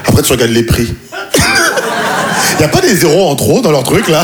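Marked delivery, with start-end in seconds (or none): 1.0-2.5 airy disbelieving laugh; breathy high laugh. Real, not acted. Comedian seeing Paris apartment prices, breathless laugh. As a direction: let out a stunned, breathless laugh.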